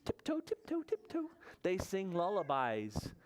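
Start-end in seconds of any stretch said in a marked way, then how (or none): 0.0-1.3 high, light little steps; tippy-toe voice. Acted. Friends creep past dozing Bear, high tiptoe voice. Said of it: "They walk on tippy toes".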